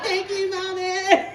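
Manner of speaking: put-on high, drawn-out child's voice; slow, grateful toddler; little boy. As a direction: squeak it like a small child. Voices three-year-old son.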